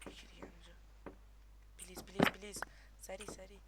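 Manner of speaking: hushed whisper; whispering while hiding. Real, not acted. Hiding game.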